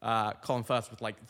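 ordinary delivery

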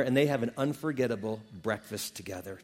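ordinary talk, nothing marked

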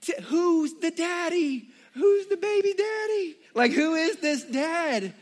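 high, loud, slowed mock outrage; Joey squawking who the father is; hammed-up jealous fiancé; panicked Joey. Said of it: "He's like".

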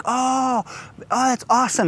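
put-on high, thrilled little-kid voice; wide-eyed child amazed at a castle; excited toddler. Dad voicing his toddler's delight at the castle, high and thrilled. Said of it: "and Austin's like".

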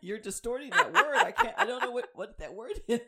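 loud mock exasperation through laughter; laughing retelling. Employee telling her boss she can't understand the accent, laughing. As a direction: crack up while acting out the complaint.

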